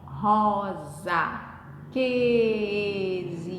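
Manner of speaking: "rosa que exibida" slow and low, every syllable held; teacher slowly sounding out each syllable of "rosa que exibida". Shown as a demonstration. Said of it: "separar as sílabas, literalmente".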